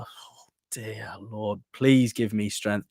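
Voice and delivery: hushed, drawn-out mock prayer. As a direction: sigh it out as if begging God for patience. Exasperated plea over Jonny Evans.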